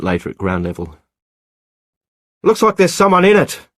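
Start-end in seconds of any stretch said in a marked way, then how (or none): 2.4-3.7 high, loud shout across the rocks; excited far-carrying shout. Acted. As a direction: call it down to her, urgent. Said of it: "he shouted".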